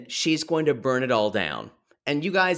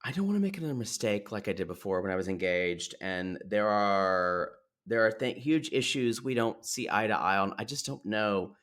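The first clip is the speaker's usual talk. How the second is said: quiet quote; 6 semitones lower, 8 dB quieter, faster than usual. Retold or imitated. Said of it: "She's like".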